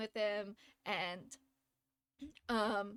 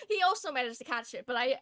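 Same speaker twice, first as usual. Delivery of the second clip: ordinary talk, nothing marked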